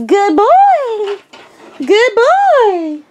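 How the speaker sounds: high, drawn-out praise; high-pitched dog-trainer praise, vowels stretched; excited puppy praise. Real, not acted.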